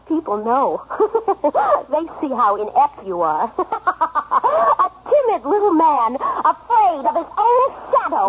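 jeering between bursts of laughter; scornful laughter. Acted. Wife ridicules timid husband, laughing scornfully. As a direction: laugh at him cruelly.

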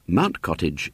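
ordinary delivery

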